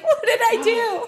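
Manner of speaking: high, loud, laughing panic; alarmed. Retold or imitated. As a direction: squeal it in mock alarm. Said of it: "I probably would have been like".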